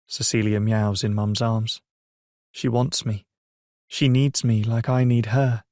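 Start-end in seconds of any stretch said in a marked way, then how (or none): none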